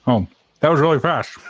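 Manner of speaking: talking through ice cream; words round a mouthful of ice cream; mouth full. Real, not acted. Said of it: "You sound pretty good with your mouth full".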